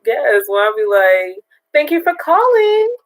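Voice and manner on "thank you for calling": high bright receptionist voice; chirpy customer-service greeting; telephone voice. Shown as a demonstration. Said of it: "that's my telephone voice".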